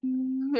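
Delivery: steady, drawn-out humming tone; healing hum. Real, not acted. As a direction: hum one long note to clear energy. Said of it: "with some sound".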